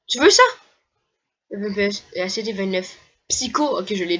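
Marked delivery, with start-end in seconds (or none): 0.1-0.4 teasing NPC offer. Acted. Voicing secretive NPC.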